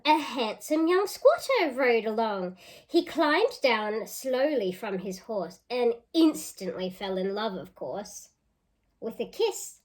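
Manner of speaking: lively storybook reading; reading to children. Acted. Teacher reading a picture book aloud to children. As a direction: read it out with relish for the kids.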